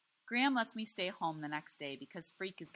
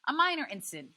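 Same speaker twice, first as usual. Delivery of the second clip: grand pronouncement; 10 semitones higher, 10 dB louder than usual. A narrator's reading. Freak plays grand scientist.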